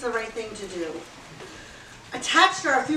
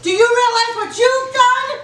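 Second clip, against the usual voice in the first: much higher, louder, slow mocking shout; shouted echo; 11 semitones higher, 9 dB louder than usual. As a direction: throw his words back, yelling. Mock echo, shouted back.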